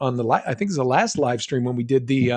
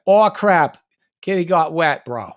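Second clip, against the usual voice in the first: put-on playful Boston accent, a bit louder; 3 semitones higher, 4 dB louder than usual. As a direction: toss it off like a Boston bro. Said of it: "you have to say in the Boston accent".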